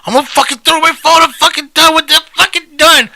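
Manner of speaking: very high, fast mock tantrum; mimicked phone rant. Friend imitates the caller threatening to throw his phone, high mock rant.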